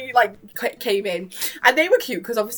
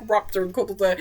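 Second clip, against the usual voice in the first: usual speaking voice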